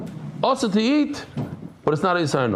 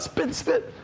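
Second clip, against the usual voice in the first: imitated spitting; 14 semitones higher, 5 dB quieter than usual. Friend spitting out chewed grass.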